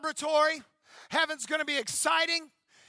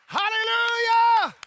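long, loud and high hallelujah; shouted hallelujah; 7 semitones higher, 7 dB louder, slower than usual. Acted. Pastor calls the church to praise, shouting. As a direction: cry it out to the rafters.

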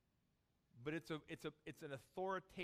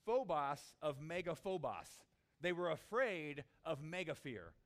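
"megaphobos" slow and louder; emphatic; 2 semitones higher, 9 dB louder than usual. Shown as a demonstration. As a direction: lean on "mega fear" slowly. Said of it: "It literally says".

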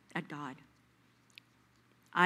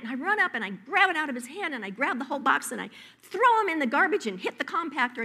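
higher and louder, breathless urgency; frantic rush; 6 semitones higher, 5 dB louder, faster than usual. Acted. Wife snatching husband's cookies, frantic and loud. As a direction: race in to snatch the box. Acted cookie grab story.

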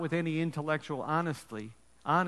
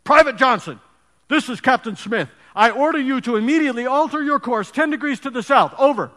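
loud, higher angry order over the radio; shouting captain; 8 semitones higher, 12 dB louder than usual. Acted. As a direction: bark the order as an outraged captain. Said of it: "the captain shouted back over the radio".

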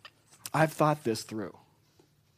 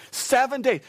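animated; 11 semitones higher, 6 dB louder than usual. Acted. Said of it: "I'm getting animated up here".

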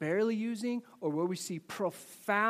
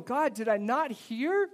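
retold distress; 7 semitones higher, 4 dB louder than usual.

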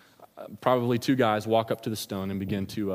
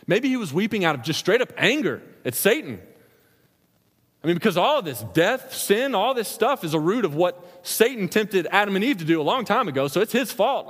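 louder, higher preaching surge; rising fervor; 8 semitones higher, 4 dB louder than usual. Real, not acted. Preacher to congregation, heated rising voice. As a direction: let the anger at Satan build. Preacher building on Satan's fault.

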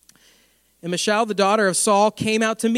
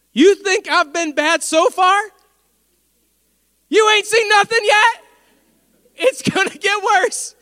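much higher, loud and slow, teasing; high, loud, slow mock threat; gleeful taunt; 11 semitones higher, 8 dB louder, slower than usual. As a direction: gloat that the worst is yet to come. David taunting his wife.

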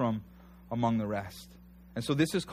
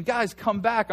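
high, raised plea to return; pitched-up, mildly shouted appeal; imitated calling out; 7 semitones higher, 4 dB louder, slower than usual. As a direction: call them back as if from afar. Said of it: "Yelling out".

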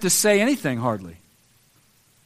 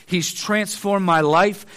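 ordinary talk, nothing marked

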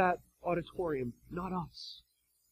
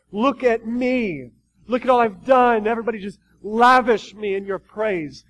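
boastful hypocrite; 2 semitones higher, 6 dB louder than usual. Retold or imitated. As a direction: brag for the crowd.

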